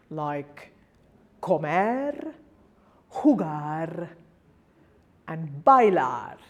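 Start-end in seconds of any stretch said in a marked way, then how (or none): 1.4-4.1 comer and jugar leaning on MER and GAR; co-MER, ju-GAR, endings held for comer, jugar; last-syllable stress. Shown as a demonstration. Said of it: "then the last syllable". 5.6-6.4 bailar with a loud, long LAR; bai-LAR, landing on the end of bailar; last-syllable stress. Shown as a demonstration. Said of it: "then the last syllable".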